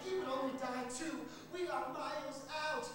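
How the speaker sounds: high, loud cry for the dog; drowning master shouting for his dog; desperate call. A narrator's reading. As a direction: beg the dog to save him. Senator calling his dog.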